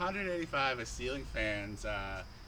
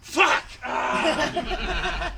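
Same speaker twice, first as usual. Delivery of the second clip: high, explosive acted curse and yell; loud, high-pitched outburst of frustration; frustrated shout; 12 semitones higher, 9 dB louder than usual. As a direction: shout it out in mock exasperation. Acted frustration after failed ceiling-fan attempts.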